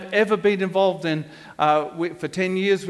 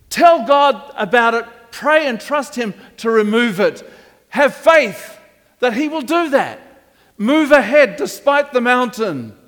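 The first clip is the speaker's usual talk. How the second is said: preaching; 4 semitones higher, 7 dB louder than usual. Acted. Preaching exhortation.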